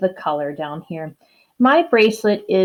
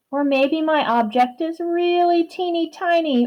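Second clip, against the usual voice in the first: tiny voice; 9 semitones higher than usual. Shown as a demonstration. Teacher acting out tiny size.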